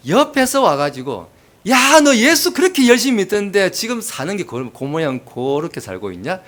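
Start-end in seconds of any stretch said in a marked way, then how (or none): 1.7-6.4 loud, high jeer that drops at the end; acted scornful needling; mock taunting. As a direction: call out a bystander's taunt. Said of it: "그게 더 기분 나쁜 거예요".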